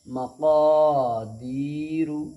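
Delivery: slow मक़ादीरु, long क़ा and दी, short रु; madd recitation. Shown as a demonstration. Teacher reading the joined word, drawn-out recitation. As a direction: hold two vowels in मक़ादीरु, clip the last. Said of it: "खींच करके अदा करेंगे".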